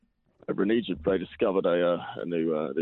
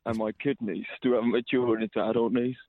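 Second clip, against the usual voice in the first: higher put-on voice; 7 semitones higher than usual. Caller's joke, much higher voice.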